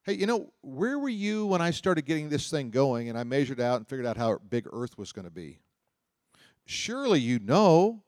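paraphrase God like a smart aleck, mocking; sarcastic God. God mocks Job's knowledge with smart-aleck sarcasm. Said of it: "Do you hear the sarcasm in God's voice?".

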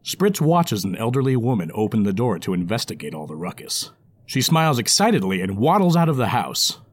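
plain reading voice

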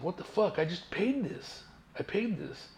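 high, softened mock outrage; indignant disbelief. Driver reacting to a second registration bill, indignant. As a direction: protest it high-pitched, incredulous.

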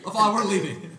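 high, loud comic declaration; mock defiant announcement. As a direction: playfully announce it at full volume. Joking quote of the leaving baby.

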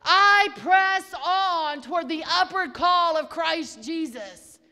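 loud, ringing preacher's declaration; full-voiced sermon cry; preached declaration. Real, not acted. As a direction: proclaim it boldly. Preaching over music.

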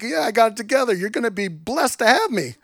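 a hint of swagger; mock bravado. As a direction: puff up like you own the room. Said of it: "You wanna be like".

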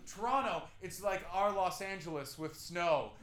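proud Canadian boast. Retold or imitated. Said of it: "we always go like".

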